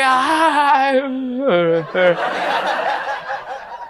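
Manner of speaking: high laugh rising like a question; Southern laugh trailing up like a question; inquisitive laugh. Retold or imitated. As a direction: laugh as if asking something. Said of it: "like an inquisitive end".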